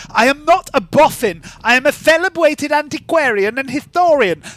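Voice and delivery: lightly voiced, high and prim; offended scholar. Scholar insisting she is no boffin. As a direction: protest with wounded dignity.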